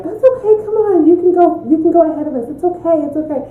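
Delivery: high, sweet voice waving her ahead; sing-song reassuring lady; kind lady voice. Retold or imitated. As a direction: play the gentle stranger in line. Ladies in line let her through.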